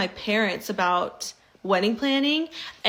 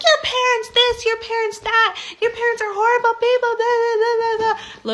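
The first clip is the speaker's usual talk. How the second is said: much higher, louder ranting shriek; mock screeching mother-in-law; 13 semitones higher, 5 dB louder than usual. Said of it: "She goes crazy".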